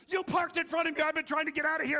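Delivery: high, loud imitated rant; angry big dude. Monstrous dude blocked in by a trailer, furious retold shouting. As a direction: yell the complaint at full volume. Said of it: "he's like".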